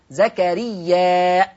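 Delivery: زكريا ending in a clear hamza; careful recitation of زكريا with hamza; Warsh hamza. Shown as a demonstration. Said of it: "بإثبات الهمزة هكذا".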